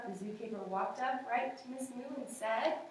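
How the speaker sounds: ordinary delivery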